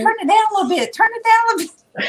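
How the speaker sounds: high-pitched, hurried plea, re-enacted; flustered plea. Telling Kevin to turn the playback down, flustered.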